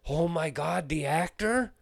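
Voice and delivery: high, loud amazed clerk voice; star-struck gasp. Retold or imitated. Clerk hearing Shia LaBeouf was there, high amazed gasp.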